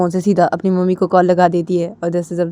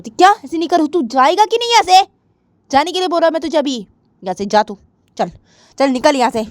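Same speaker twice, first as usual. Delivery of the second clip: sharp, louder, ordering him out; angry girl; 9 semitones higher, 5 dB louder than usual. Acted. Jiya telling Adi to get out, angry. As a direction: throw him out of the room.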